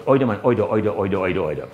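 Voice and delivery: Bajan "either" turned to "oy-da"; Bajan accent. Acted. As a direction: repeat it in a comic Bajan accent, sinking in pitch. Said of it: "Bajans think they talk their best".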